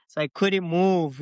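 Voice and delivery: usual speaking voice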